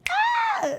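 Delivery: very high squeal of pure joy; excited squeal. Retold or imitated. Recalling how hyped she was for her cousin, high squeal. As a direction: let out a tiny happy shriek. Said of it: "'cause I was geeked".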